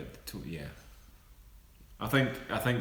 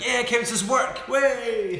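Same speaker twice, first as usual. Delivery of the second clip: loud, high, gleeful whoop; mock cheer; 8 dB louder, slower than usual. Hosts to stream viewers on getting a donation, whooping loudly. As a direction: celebrate like you just got paid. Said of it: "we're like".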